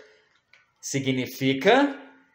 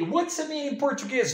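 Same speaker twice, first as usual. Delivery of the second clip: put-on much higher, fast child's voice; childlike pupil question; 11 semitones higher, much faster than usual. Teacher plays a child asking the meaning in a high voice. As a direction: squeak it out like a little pupil.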